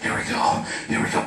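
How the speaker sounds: hushed, whispered self-talk; soft, anticipatory whisper; in his head. Acted. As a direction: psych yourself up under your breath. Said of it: "And in my head".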